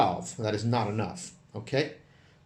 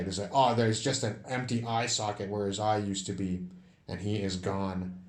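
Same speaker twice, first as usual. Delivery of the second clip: ordinary talk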